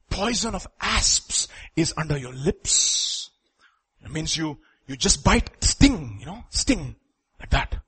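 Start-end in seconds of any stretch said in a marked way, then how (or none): 0.1-3.3 slow hushed emphasis. Real, not acted. Preacher to parents, soft and slow on poison. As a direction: drop to a slow, soft, ominous line.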